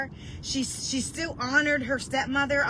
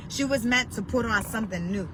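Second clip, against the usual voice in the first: ordinary talk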